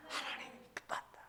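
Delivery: very soft, voiceless whisper; whispered prompt. Real, not acted. Preacher feeding the congregation the answer, whispered.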